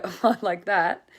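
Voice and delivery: light laughing remark; a teasing little laugh. Retold or imitated. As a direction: chuckle it off lightly. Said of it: "I have a little laugh".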